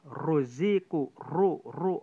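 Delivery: ruziku, ru, ru with a very thick ro; very heavy ro. Shown as a demonstration. Teacher drilling the very heavy ro for students. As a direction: repeat ru with a dark, heavy r. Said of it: "itu tebal sekali".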